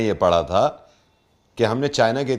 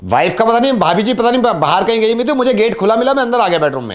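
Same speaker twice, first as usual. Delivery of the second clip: higher-pitched, matter-of-fact intruder act; brazen builder; 8 semitones higher, 5 dB louder than usual. Pretend. Builder explains entering the open bedroom, brazen.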